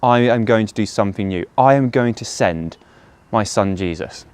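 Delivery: ordinary talk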